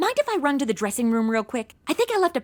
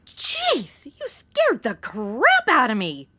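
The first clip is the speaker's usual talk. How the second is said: startled; slower than usual. Acted. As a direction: caught off guard, gasp it out. Startled by gunman.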